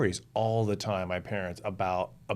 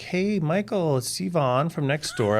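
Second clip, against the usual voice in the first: lightly voiced neighbor, pitched up; mock friendly phone greeting, a touch higher; 6 semitones higher than usual. Neighbor phones a latchkey kid, lightly imitated friendly caller.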